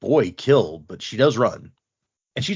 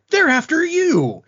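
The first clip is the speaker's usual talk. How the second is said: Lift insisting; 5 dB louder than usual. Retold or imitated. Lift insisting in retold story.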